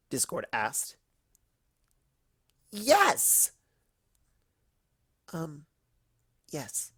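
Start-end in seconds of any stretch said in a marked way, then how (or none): 2.7-3.5 high loud reply; shouted yes. Acted. Ponies answer Discord, loud high shout. 5.3-6.9 much softer, hesitant; timid. Acted. Fluttershy agreeing, soft and timid. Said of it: "Fluttershy agreed timidly".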